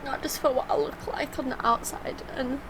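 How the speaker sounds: choked-up and tearful, voice climbing high; fighting back tears while thanking her husband; breaking into tearful little laughs; emotional. Real, not acted. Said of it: "no wonder she becomes so emotional".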